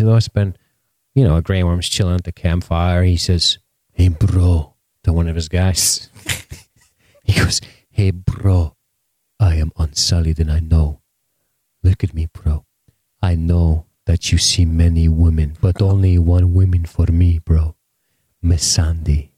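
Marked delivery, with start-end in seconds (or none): 3.9-4.7 Grey Worm in mock Jamaican; chilled-out greeting in a put-on Jamaican accent. Soldier calls his mate at the campfire, mock Jamaican accent. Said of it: "I don't know why he's talking Jamaican". 7.9-19.3 lower, mock Jamaican accent; earnest confiding in a put-on Jamaican accent; Grey Worm. Lovestruck soldier confiding in bros at campfire, mock Jamaican accent. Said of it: "I don't know why he's talking Jamaican".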